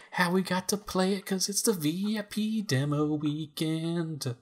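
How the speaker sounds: high, drawn-out hype; overexcited. Acted. Host telling listeners about the demo weekend, way too excited. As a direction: stretch it out with over-the-top excitement. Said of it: "You sounded way too excited for that".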